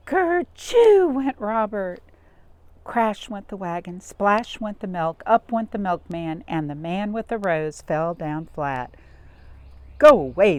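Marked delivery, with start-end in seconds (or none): 0.1-2.0 a high, loud, slow "Kerchoo" sneeze; big sneeze. Acted. 10.0-10.6 loud angry shout; angry order. Acted. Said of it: "the milkman told Robert".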